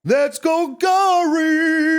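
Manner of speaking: high, very slow epic announcer call. Pretend. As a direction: boom it out like an arena announcer. Catchphrase, bigger second take.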